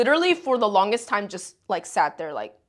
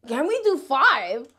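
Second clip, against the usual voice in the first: slow, higher-pitched mocking echo; incredulous mock repeat; 4 semitones higher, 3 dB louder, slower than usual. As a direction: throw the offer back in disbelief, drawn out. Repeats his offer, incredulous.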